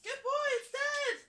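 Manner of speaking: very high praising dog voice; pet praise. Real, not acted. Praising dog after killing fly.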